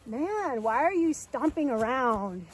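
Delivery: loud, drawn-out complaint; loud mock indignation, words stretched out; indignant horse. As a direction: protest loudly as the put-out horse. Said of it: "They were like".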